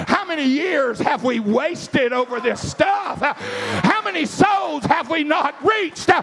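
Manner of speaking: high, driving revival cry; full-force preaching. Real, not acted. Preacher challenging the church over wasted years, full-force high preaching. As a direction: drive each question out as a high preacher's cry.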